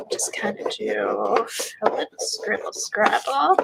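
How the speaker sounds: normal talking voice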